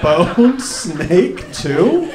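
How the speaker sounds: slow, stretched-out disbelief with a laugh; incredulous. Retold or imitated. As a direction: drag out the name, laughing in disbelief. Incredulous at dad's username.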